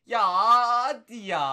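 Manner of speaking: slow, loud, stretched "hadi"; urging the pack out loud; loud drawn-out plea. Real, not acted. Begging a pack to improve.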